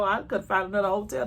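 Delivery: ordinary talk, nothing marked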